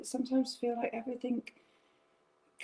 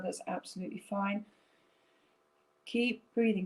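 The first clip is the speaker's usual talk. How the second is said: guided breathing meditation; 2 semitones lower than usual. Acted. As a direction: lead them gently through each breath.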